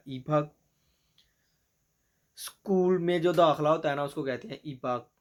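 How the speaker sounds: ordinary talk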